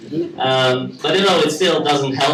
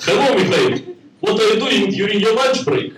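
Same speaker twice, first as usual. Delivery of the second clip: higher, hammed-up fake Russian accent; 7 semitones higher than usual. Comic voicing a Russian colleague, in a hammed-up Russian accent. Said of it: "has to fake Russian accent".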